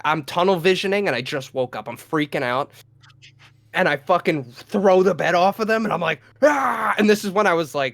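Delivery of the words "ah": high, short imitated war cry; mock scream. Flipping bed off intruder, mock scream. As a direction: act out a caveman yell. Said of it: "just screaming at the top of my lungs".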